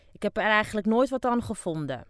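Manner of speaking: loud, high, "nooit" stressed; loud dismissive wife. Acted. Deaf old wife loudly admits she never enjoyed it. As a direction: shout it with a shrug, hit "nooit".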